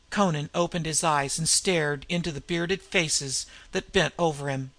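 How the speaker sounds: plain reading voice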